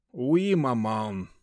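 slow, resigned sigh; weary, dragged-out answer; sighing reply. A narrator's reading. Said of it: "со вздохом ответил". (со вздохом, устало)

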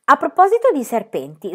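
sudden, mildly excited outburst; blurting out. Acted. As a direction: burst in with a new topic. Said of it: "sbottò Pippi".

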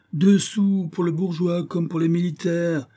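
high sing-out sales pitch; market-seller patter, pitched high; street hawker. A narrator's reading. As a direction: cry your wares like a street peddler. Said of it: "sous le ton d'un camelot".

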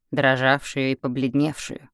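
usual speaking voice

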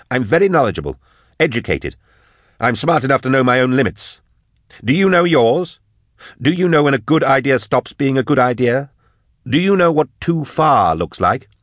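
high, indignant arguing voice; heated, defiant Drusha. Acted. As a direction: challenge him with mounting pitch.

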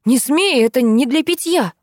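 high, urgent warning cry; alarmed shout. A narrator's reading. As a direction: cry out to stop him. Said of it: "закричала я". (тревожный крик)

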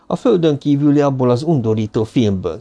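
ordinary delivery

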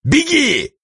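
very high, furious bark; furious thunder. A narrator's reading. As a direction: roar at him in fury. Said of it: "он бешено загремел".

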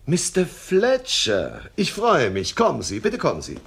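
high, swooping, delighted greeting; big theatrical welcome, pitch leaping high; effusive welcome. Acted. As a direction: greet a guest with gushing enthusiasm. Boss effusively greets expected applicant.

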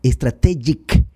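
slow strategic as "es-tra-TEH-jik"; president's mispronunciation. Retold or imitated. Commentator quoting the president, strategic mispronounced "es-tra-TEH-jik". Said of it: "Y dice estra".

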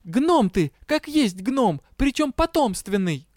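high, indignant name-calling; incensed. A narrator's reading. Angered Дэя calls money-minded partner a gnome, incensed. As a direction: fling it at him angrily. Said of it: "И разгневанная".

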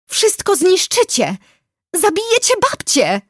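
put-on girl screaming to protect her grandmother; screamed protest. A narrator's reading. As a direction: shriek it in desperation. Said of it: "wrzasnęła i skoczyła".

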